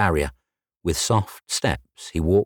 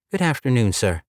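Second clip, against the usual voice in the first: lightly voiced polite receptionist, higher; a hint of a bright front-desk girl, pitched up; 6 semitones higher than usual. Red-haired receptionist greeting Jay, lightly higher polite voice.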